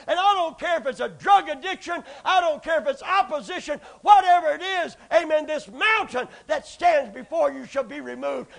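loud, high, building sermon cry; fiery preaching. Acted. Preacher building to a shout to the church, fierce. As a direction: rise to a shout.

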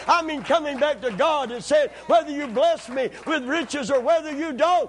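high, loud preaching shout; rising preacher's shout; full-force preaching. Acted. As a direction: cry it out to the congregation. Climactic call to return.

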